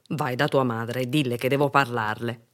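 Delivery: low, firm man's voice; grown-up with a complaint; stern postman. A narrator's reading. Postman orders the girl.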